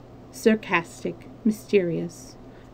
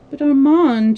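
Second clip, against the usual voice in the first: louder, higher urgent plea; pleading; 6 semitones higher, 8 dB louder than usual. A narrator's reading. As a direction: beg him for her brother. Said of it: "she pleaded".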